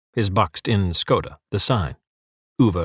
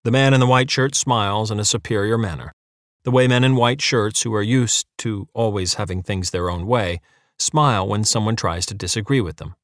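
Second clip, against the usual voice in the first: plain reading voice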